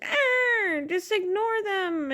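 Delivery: high, whiny mock voice; mocking imitation. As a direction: mimic them with a wailing "ah". Mocking what other people say.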